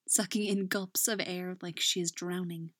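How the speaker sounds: ordinary delivery, nothing marked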